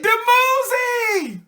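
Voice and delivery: high, slow, drawn-out cry of a name; ecstatic call of a lover's name. Retold or imitated. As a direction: call out the lost beloved's name in delight, stretching it high. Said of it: "she said".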